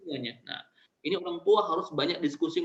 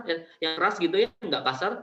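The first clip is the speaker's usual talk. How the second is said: ordinary talk, nothing marked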